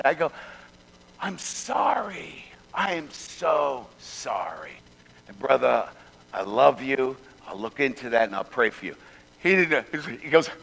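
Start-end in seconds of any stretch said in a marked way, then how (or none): 1.2-8.9 calm the angry man down softly; quiet meek apology to a furious driver. Retold or imitated.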